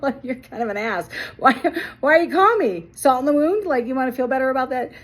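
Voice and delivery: high, loud retold disbelief; mock-offended, challenging the caller; amused indignation. Retold indignant thought at boss.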